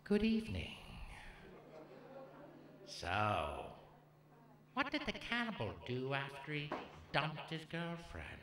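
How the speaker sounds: slow, measured villain impression with long pauses; Hannibal Lecter. Cannibal as stand-up comedian greets the crowd, slow Hannibal Lecter impression. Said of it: "the only guy that could possibly deliver this joke is Hannibal".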